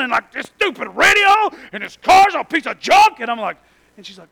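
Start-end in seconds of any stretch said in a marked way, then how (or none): 0.3-3.2 loud mock rant, much higher; yelling wife in labor. Wife in labor yelling at the car, loud mock rant. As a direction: rail at the radio and the car. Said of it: "starts yelling".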